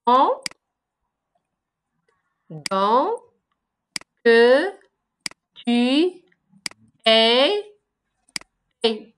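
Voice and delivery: each syllable said apart with long pauses; slow syllable-counting read. Shown as a demonstration. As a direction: tap out every syllable separately. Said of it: "Chaque vers se forme des syllabes".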